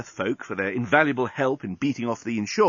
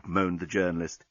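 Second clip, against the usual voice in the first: ordinary delivery, nothing marked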